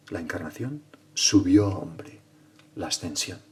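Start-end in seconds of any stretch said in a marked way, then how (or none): 1.1-2.2 very slow, falling close; weighty maxim. Acted. Preacher completing San Ambrosio's maxim, slow falling second half. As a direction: stretch it out and let the tune fall.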